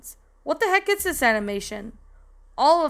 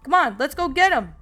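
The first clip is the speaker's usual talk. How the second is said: higher-pitched rallying shout, mildly done; rally the gang with a slightly raised yell; 4 semitones higher, 4 dB louder than usual. A narrator's reading. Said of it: "Einstein shouted".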